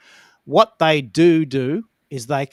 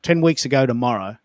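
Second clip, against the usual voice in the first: usual speaking voice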